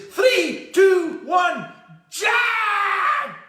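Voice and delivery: full-out high-pitched screamed countdown. Acted. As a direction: bellow it, stretching the final JUMP. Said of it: "he screams to this horse".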